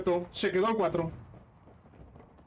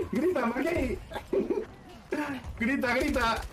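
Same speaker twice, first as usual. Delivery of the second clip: teasing through real laughter, higher pitch; laughing tease; 5 semitones higher, slower than usual. Real, not acted. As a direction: egg him on while cracking up. Laughing, teasing burning teammate.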